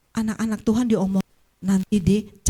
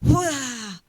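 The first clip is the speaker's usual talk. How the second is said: ordinary delivery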